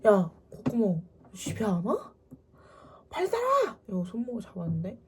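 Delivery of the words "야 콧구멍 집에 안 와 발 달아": angry scolding that rises to a high shout; acting out a mother losing her temper; imitated furious mother. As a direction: order the daughter home, pitch leaping at the end. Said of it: "엄마 진짜 너무 화가 나가지고".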